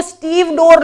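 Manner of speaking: स्टीव डोर in two pieces, silent e after v; common misreading. Shown as a demonstration. Teacher warning students how it first looks, common misreading. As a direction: say स्टीव डोर like Steve and door. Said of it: "ज्यादातर बच्चे इसे स्टीव डोर पढ़ते हैं".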